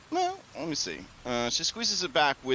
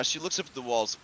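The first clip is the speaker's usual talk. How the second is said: ordinary delivery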